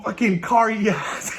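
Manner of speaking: ordinary delivery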